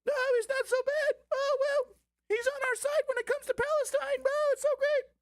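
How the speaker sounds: squeaky falsetto mock voice, far above normal; cartoonish high-pitched caricature; Cenk Uygur impression. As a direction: parody him in a tiny high squeal. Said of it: "That's my Cenk Uygur impression".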